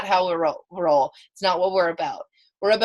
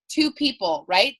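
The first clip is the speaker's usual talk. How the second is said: ordinary talk, nothing marked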